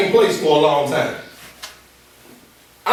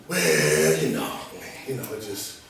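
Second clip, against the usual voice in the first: mock mumbling, much lower and softer; lazy interviewee; 9 dB quieter, slower than usual. Speaker mocking a too-comfortable job applicant, low lazy mumble. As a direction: slump into a lazy, mumbled non-answer. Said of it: "And you at this point".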